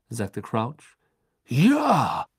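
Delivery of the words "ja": blurted. A narrator's reading. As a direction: blurt it out, high and loud. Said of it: "stieß Karkaroff hervor".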